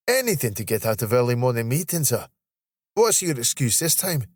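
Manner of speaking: playful Scottish ribbing; teasing Scottish colleague. Acted. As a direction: needle a late colleague in broad Scots. Voices Steve, Scottish colleague.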